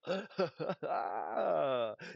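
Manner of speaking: short real laugh at a bad pull; rueful gamer laugh. Real, not acted. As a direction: laugh it off, amused. Laughing at a siege general pull.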